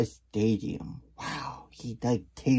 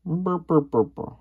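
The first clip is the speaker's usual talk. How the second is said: chirpy burr; 7 semitones higher, 6 dB louder than usual. Acted. Character chirping sound.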